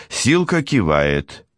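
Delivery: ordinary delivery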